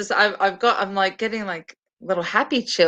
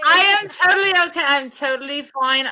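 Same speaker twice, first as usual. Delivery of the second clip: high, effusive delight; gushing reassurance; 6 semitones higher than usual. Real, not acted. Host telling friend's mum she is okay, gushing. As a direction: reassure with an excited gush.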